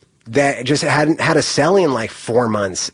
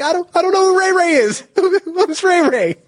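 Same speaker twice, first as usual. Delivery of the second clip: laughing panic. Retold or imitated. Panicked denial on arrival.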